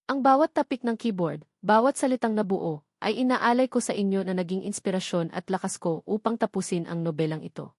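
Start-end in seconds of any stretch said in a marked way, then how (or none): none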